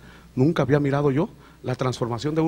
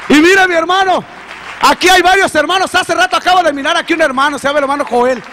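high, loud, fervent testimony; rising, loud pulpit voice; preaching at full force; 11 semitones higher, 6 dB louder than usual. Acted. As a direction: preach it out to the congregation. Tearful climax of testimony preached.

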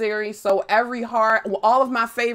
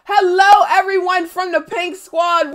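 bright, loud welcome to raiders; excited greeting; 8 semitones higher, 6 dB louder than usual. Real, not acted. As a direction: greet the crowd with energy.

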